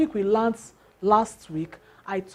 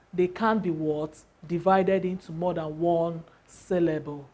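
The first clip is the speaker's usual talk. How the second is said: normal talking voice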